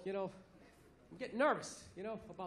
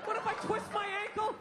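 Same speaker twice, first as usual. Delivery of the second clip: high, quick panicked squeal; mock panic; 8 semitones higher than usual. Comic voicing an adult panicking without phone or ID, high and quick.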